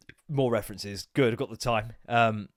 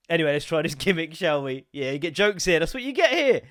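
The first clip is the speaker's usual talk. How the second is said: usual speaking voice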